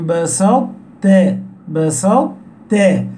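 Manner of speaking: "بسطت" slowly, emphatic ط unreleased into a doubled light ت; إطباق ثم تاء مشددة. Shown as a demonstration. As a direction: close heavily on ط in "بسطت", then land on a clear ت. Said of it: "ليس بالطاء كلها ولكن بصفة الإطباق". ("بسطت" ببطء، بإطباق الطاء ثم تاء مشددة)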